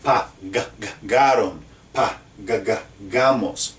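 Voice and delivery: Pa-ga-ga-garon repeating the ga to show the hard g; stuttered g syllables. Shown as a demonstration. Teacher drills the g sound for students. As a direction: hammer the g sound in Pa-ga-ga-gamos. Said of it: "Are you noticing a pattern?".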